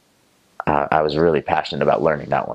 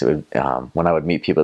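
usual speaking voice

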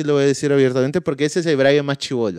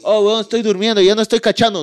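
caught-out Brian; 8 semitones higher, 4 dB louder than usual. Retold or imitated.

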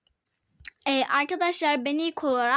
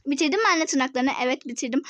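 ordinary delivery

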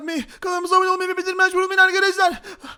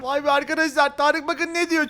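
ordinary talk, nothing marked

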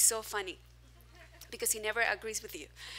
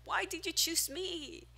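crying complaint; 7 semitones higher than usual. Retold or imitated. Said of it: "I was crying with God".

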